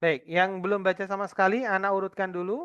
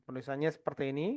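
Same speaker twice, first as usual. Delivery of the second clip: normal talking voice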